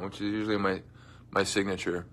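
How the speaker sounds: ordinary delivery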